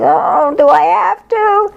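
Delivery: mock whine, pitched high; whiny reluctance. To her guide, a high mock whine of protest.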